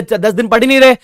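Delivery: mock the student who skips ten days; lazy student. Teacher imitating a slacking student, light mock voice. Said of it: "फिर बोले".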